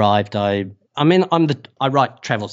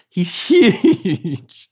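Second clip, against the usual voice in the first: higher and louder, laughing mid-word on huge; 10 semitones higher, 4 dB louder than usual. Retold or imitated. Stressing the driver's size to the host, laughing. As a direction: break into a laugh as you say He's huge.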